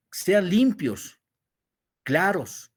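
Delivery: "sean limpios" stretched slow and high; emphatic, slow. Shown as a demonstration. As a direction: lean into each word like a preacher. Predicador enfatiza cómo cantar. ("limpios" alargado y alto, con énfasis)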